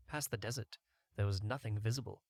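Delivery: ordinary delivery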